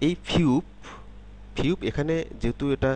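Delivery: normal talking voice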